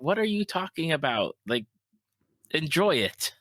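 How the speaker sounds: exasperated parent. Retold or imitated. Parent protesting to kids who find the game boring, incredulous. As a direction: plead in disbelief with the kids. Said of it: "I'm like".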